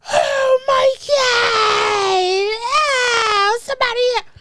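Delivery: high, loud, drawn-out wailing cry; hysterical crying. Retold or imitated. Mother after a bike crash, wailing high and loud for help.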